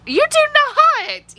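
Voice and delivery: drawn-out, very high protest; incredulous squeal. Real, not acted. Protesting friend's Donald Duck remark, incredulous squeal. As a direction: squeal it slowly in disbelief.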